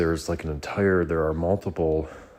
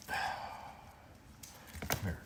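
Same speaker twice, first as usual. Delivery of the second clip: whispered call; 2 semitones higher, 16 dB quieter than usual. Real, not acted.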